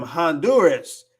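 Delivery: Honduras as han-DUR-us, H sounded; American pronunciation. Shown as a demonstration. How it's said in the States, Honduras with English H. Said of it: "aquí en los Estados Unidos".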